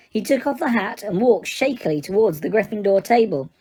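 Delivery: ordinary delivery, nothing marked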